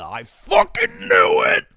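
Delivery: high, strained, slow mock shout; vindicated outburst. Acting out someone seeing their bias confirmed, vindicated outburst. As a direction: cry it out like you were right all along. Acts out a biased knee-jerk reaction.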